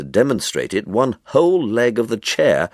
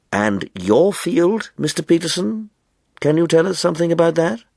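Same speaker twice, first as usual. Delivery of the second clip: courteous chairman; 10 semitones higher than usual. A narrator's reading. Chairman politely asks Peterson across the table, courteous. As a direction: lean in and ask politely. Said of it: "said courteously".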